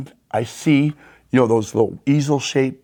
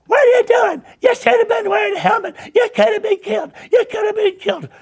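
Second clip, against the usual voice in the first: put-on scolding old ladies; faster than usual. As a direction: squawk it like a frantic old lady telling off a kid. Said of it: "two old ladies jump out of the truck going".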